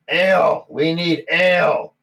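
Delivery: acted tavern shout from a dragonborn. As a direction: call out loud and high, stretching the words. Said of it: "red's gonna shout that".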